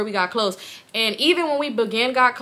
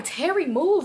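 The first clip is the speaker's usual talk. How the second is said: higher-pitched plea, reenacted; retold protest; 7 semitones higher, slower than usual. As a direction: imitate telling him to move.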